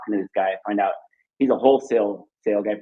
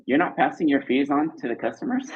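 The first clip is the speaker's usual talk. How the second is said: higher-pitched surprised question; imitated astonished customer, pitched up; incredulous question; 5 semitones higher than usual. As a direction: mimic a wife's mild disbelief. Wife surprised at the merchant.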